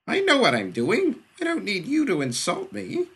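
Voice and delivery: high, loud and stung; a proud boy lashing out; offended kid. Acted.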